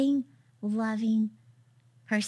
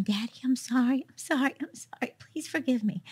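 high, tearful pleading that falls to a whisper; retold broken apology; 4 semitones higher than usual. Daughter begging her father's forgiveness on the phone, tearful pleading. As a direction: beg forgiveness through tears, barely holding it together. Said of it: "And I just broke".

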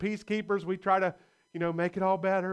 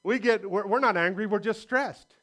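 ordinary talk, nothing marked

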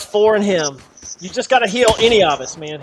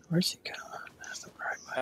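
whispering; 17 dB quieter than usual. Real, not acted. Whispered in-game lines.

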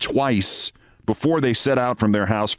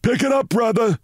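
high, barked order from a cop; cop snapping; 14 semitones higher than usual. A narrator's reading. Traffic cop snapping at Mitty to drive on. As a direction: snap it at the dawdling driver. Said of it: "snapped a cop".

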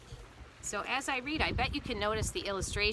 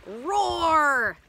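loud, higher drawn-out roar; engine roar; 5 semitones higher, 6 dB louder than usual. A narrator's reading. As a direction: roar like a starting motor. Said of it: "The engine roars".

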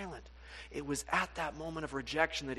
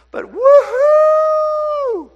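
long held, much higher whoop; wild joyful shout; 22 dB louder, much slower than usual. Retold or imitated. Preacher acting a forgiven believer's joyful shout, long and loud. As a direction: let out a huge celebratory woohoo.

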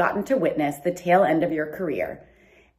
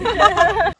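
bright laugh; 14 semitones higher, 7 dB louder than usual. Real, not acted. Laugh after shouted farewell.

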